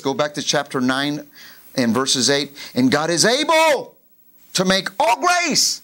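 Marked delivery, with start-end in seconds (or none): 2.7-5.8 rising, slow proclamation; preacher lifting the verse to the church, loud and slow; proclaiming scripture. Real, not acted. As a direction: build the verse to a peak. Reading scripture with force.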